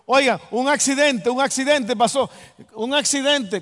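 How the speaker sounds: loud, high alarmed shout; calling out alarm. Retold or imitated. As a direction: yell the news to the house urgently. Calling neighbors about a crash. (gritando alarmado para avisar del accidente)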